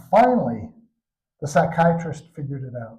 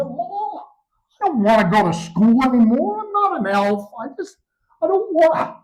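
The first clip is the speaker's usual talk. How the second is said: put-on high childish whiny voice; child's whine; 8 semitones higher, 3 dB louder than usual. Boy telling his mom he won't go to school, high whiny child voice. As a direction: pitch it up like a hurt little kid.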